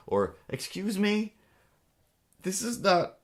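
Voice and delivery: high, slow indignant excuse me. Real, not acted. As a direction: rise up in offended disbelief.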